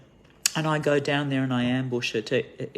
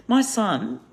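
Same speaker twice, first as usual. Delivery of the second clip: usual speaking voice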